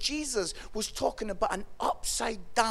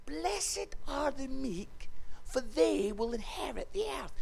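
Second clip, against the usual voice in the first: hushed repeat; 2 semitones higher, 4 dB quieter than usual. Acted. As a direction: repeat it gently, hushing as it goes. Jesus repeats it for baffled listeners.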